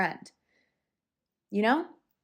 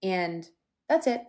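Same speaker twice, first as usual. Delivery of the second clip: ordinary talk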